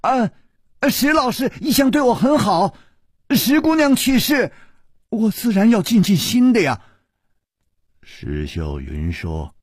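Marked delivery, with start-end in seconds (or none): 0.0-6.8 raised and high, a sudden excuse; loud, high voice. A narrator's reading. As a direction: speak up high and eager. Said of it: "大声地说".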